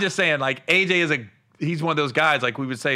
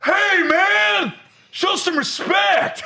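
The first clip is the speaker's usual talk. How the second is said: scolding shout; 4 dB louder, much slower than usual. Retold or imitated. Old man scolds again.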